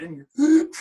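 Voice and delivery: put-on high, loud squeak of a choked kid, laughing; mock a choked voice through laughter; a laughing imitation of a choked boy; laughing strangled reply. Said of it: "He said".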